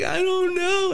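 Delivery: very high-pitched helpless whine; hammed-up clueless protest; mock whining. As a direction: imitate a drunk girl's squeaky wail. Acting out the crying girl's reply.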